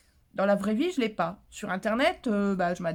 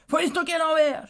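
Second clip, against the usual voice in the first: loud, higher, blunt order; curt bossy tip; 7 semitones higher, 6 dB louder than usual. Retold or imitated. Quoting the viewer's advice, curt bossy tip. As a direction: snap out the advice like a know-it-all. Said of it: "Elle me dit".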